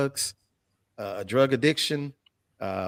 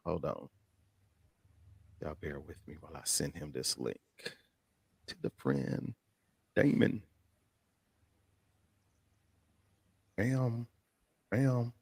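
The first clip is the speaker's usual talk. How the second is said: much softer, lower aside; muttered aside; 8 semitones lower, 11 dB quieter than usual. Real, not acted. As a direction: mutter it to yourself. Aside while sending link.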